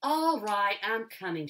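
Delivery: loud exasperated shout back; a girl answering her mother, loud and exasperated; exasperated cry. A narrator's reading. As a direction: throw it back with fed-up impatience. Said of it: "she cried, exasperated".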